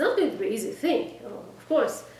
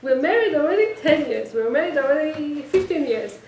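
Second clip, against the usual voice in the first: loud, higher mock outrage; upset wife; 3 semitones higher, 7 dB louder than usual. Wife scolds husband over a forgotten birthday present, mock upset. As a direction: imitate a wife's indignant complaint. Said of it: "And you get so upset".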